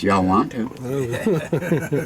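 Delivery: slow, laughing Southern drawl on Y'all; a lazy Southern "Y'all want to?" with a laugh; playful Southern accent demo. Shown as a demonstration. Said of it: "we talk Southern accents".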